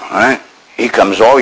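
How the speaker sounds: normal talking voice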